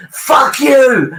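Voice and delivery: high gloating yell; taunting the rival fans; mock triumphant yell; goal celebration. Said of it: "I was raging".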